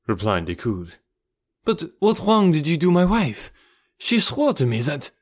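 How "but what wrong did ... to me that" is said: high, agitated questioning; distressed demand. A narrator's reading. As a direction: press him for the truth, voice raised in pitch. Husband demands truth about his wife.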